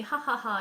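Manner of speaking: sneering fake laughter; mocking laugh. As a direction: imitate a bully's jeering laugh. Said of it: "and laugh at me and say".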